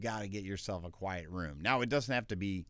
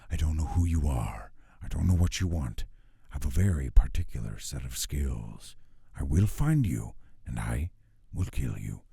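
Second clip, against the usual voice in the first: much lower, louder, menacing gravel of a trailer; movie trailer whisper; 6 semitones lower, 9 dB louder than usual. Shown as a demonstration. Voice actor demoing a movie trailer line, low menacing whisper. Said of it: "a whisper sound, like a movie trailer".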